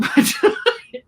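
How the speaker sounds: unvoiced airy chuckle rising; quick laugh out on the breath; breathy giggle. Real, not acted. Laughing at the captain.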